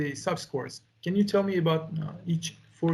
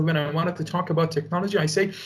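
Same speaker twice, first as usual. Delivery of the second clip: ordinary delivery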